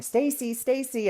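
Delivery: high calling voice, mild mock yell; calling a dog. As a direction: call out to a runaway dog. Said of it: "this person start yelling".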